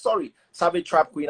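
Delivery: ordinary talk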